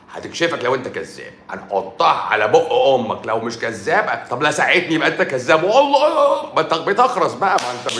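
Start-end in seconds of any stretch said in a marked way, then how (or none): 5.6-7.6 mock angry outburst. Angry outburst at a fake liar.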